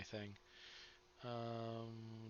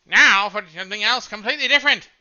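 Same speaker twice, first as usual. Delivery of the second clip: loud, high wartime broadcast voice; old-timey radio announcer; 8 semitones higher, 15 dB louder than usual. Shown as a demonstration. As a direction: boom it out like an old newsreel. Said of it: "that would sound like this".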